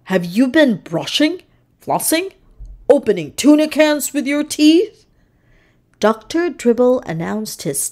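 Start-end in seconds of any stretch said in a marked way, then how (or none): 0.1-5.1 a hint of a playful dentist voice, rising at the end; lightly voiced dentist. A narrator's reading. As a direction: tease the patient lightly, a little higher. Dentist voicing a character.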